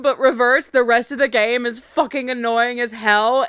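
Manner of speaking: ordinary talk, nothing marked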